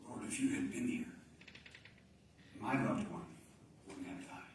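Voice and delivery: trailing off to near-silence; hushed lament. Acted. Preacher voicing a grieving listener's plea, barely audible. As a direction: let the last plea fade to a breath.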